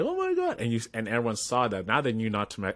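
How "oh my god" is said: high, squeaky mock shock; stunned gasp. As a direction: squeak it out like a dazed kid. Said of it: "he kinda just like".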